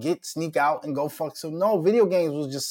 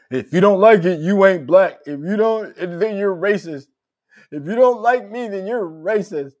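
mocking [name]; 6 semitones higher, 8 dB louder than usual. Mocking [name]'s race argument.

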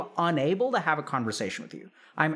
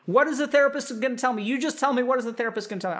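pushy parent; 6 semitones higher, faster than usual. Acted. Acted parent demanding answer.

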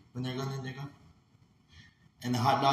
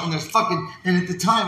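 ordinary talk

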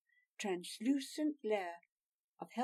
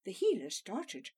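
ordinary delivery

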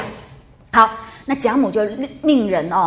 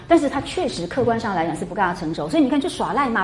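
ordinary talk

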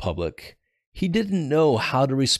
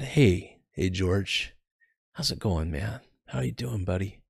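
gentle concern. Retold or imitated. Comrade comforts stressed friend.

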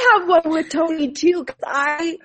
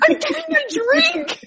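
laughing through it; 13 semitones higher than usual. Retold or imitated.

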